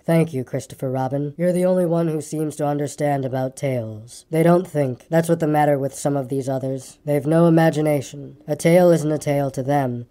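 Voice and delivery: low, gloomy donkey voice; gloomy, long-suffering and low; a low, woeful grumble; melancholy grumbler. Acted.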